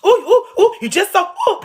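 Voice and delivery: high, breathless fawning coos with a giggle; mock swooning woman. Mocking grown women fawning over her toddler, in high giggly coos. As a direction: gush over the baby like a smitten fan.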